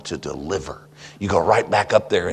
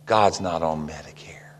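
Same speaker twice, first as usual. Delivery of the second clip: hushed punchline; 6 semitones lower, 6 dB quieter than usual. Acted. Punchline repeated quietly.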